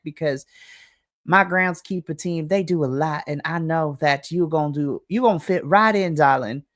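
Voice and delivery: a syrupy Southern welcome, honey and darling; Southern lady. Acted. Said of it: "This is very Southern, like, lady".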